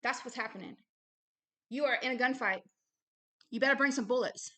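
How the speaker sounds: ordinary talk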